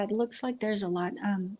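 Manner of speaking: ordinary talk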